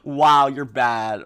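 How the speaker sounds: loud jeering laugh, drawn-out scornful wow; negative mockery. Friends ridiculing a player who screwed up, negative mockery. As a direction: ridicule them with a hammed-up laugh. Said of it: "if they say it like that".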